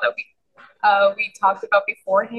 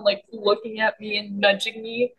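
ordinary delivery

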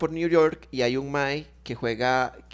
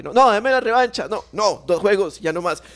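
begging for a rematch; 6 semitones higher, 6 dB louder than usual. Retold or imitated. Retold chess loser begging.

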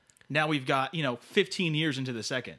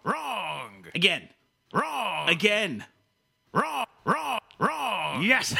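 high, loud triumphant barks; gleeful villain shouting; 7 semitones higher, 4 dB louder, much slower than usual. Acted. Villain torments contestant who gave up, gleeful hammed-up shouting. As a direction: crow over a failure like a hammy villain.

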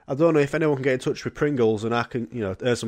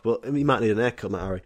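ordinary talk

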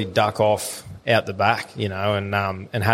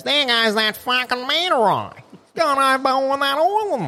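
high, muffled voice as if through a snorkel; Snorkel impression; 13 semitones higher than usual. Mate imitating Snorkel from the port, high snorkel-muffled voice. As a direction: talk like a man with a snorkel in his mouth. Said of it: "he goes".

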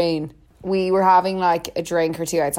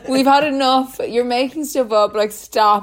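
mock telling off; 6 semitones higher, 4 dB louder than usual. Everyone telling Latrine off.

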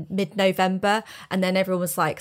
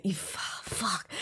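much softer, voiceless swearing; whispered curse; 2 semitones lower, 13 dB quieter, slower than usual. Retold or imitated. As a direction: hiss it under your breath. Ex-partner swearing under breath.

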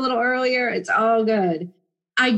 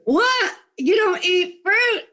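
much higher mock shock, drawn-out disbelief; incredulous reaction; 10 semitones higher, slower than usual. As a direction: play the shocked friend hearing she skips fruit. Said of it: "I get that reaction".

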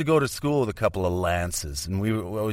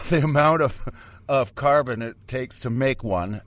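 ordinary talk

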